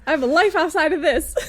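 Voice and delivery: high, loud, half-laughing complaint; mock exasperated protest. Fed-up producer protesting the job, high loud mock wail. Carries on the mock quitting rant.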